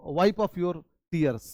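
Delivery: wipe off your tears with tears said like टीयर, slowly; slow model read. Shown as a demonstration. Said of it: "wipe off माने होता है पोंछना". (धीरे-धीरे सही उच्चारण)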